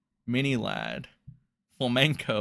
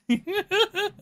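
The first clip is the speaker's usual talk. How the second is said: high burst of laughter. Real, not acted. Laughing at his own joke.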